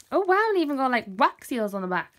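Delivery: high, loud excited gush. Real, not acted. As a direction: burst out with real excitement. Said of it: "Sorry, I'm getting excited".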